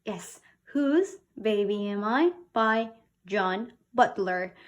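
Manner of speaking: usual speaking voice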